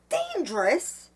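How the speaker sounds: high, loud, drawn-out indignant question; furious. A narrator's reading. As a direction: throw the word back in outrage. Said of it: "In her fury".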